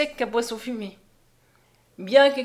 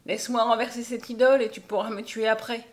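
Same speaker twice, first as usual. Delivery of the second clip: plain reading voice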